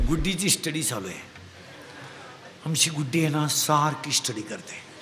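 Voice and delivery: put-on low, hushed parent praising their child; soft, low-pitched proud-parent voice; boastful parent. Acting parent boasting about Guddi's studies.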